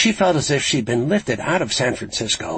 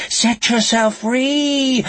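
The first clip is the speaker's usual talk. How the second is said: high, slow, loud sing-song chant; Nightbird; 14 semitones higher, 5 dB louder, much slower than usual. A narrator's reading. Killer urges Lucy from overhead speakers, chanting. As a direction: stretch it out as a sing-song lure. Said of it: "The Nightbird's sing-song voice chanted from overhead speakers".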